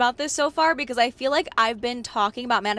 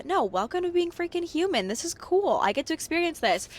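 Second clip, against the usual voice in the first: much higher, cheery pep talk; upbeat self-encouragement; 8 semitones higher than usual. Retold or imitated. Telling herself being human is cool, cheerfully upbeat. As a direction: brightly talk yourself round, pitched way up.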